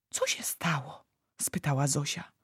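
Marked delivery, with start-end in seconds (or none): 0.1-1.0 put-on very soft girl's query; hushed question. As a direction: ask it almost under the breath. Girl timidly asks the giant.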